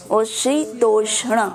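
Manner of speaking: शीतोष्ण said slowly with weight on the final ण; stress on ण. Shown as a demonstration. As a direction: lean on the ण in शीतोष्ण. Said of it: "जिस पे जोर लगाना है".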